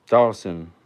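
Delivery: shouted name drop; loud call-out. Acted. Calling out a name.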